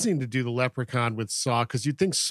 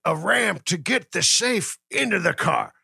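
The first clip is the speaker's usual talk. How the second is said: lightly voiced character, pitched up; acted movie character; 5 semitones higher than usual. Podcaster plays Morty scheming about the safe, lightly voiced. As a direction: put on a higher voice for the schemer.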